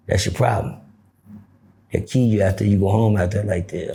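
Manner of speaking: put-on low, serious old man's warning; grave old man. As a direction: drop it deep and grave.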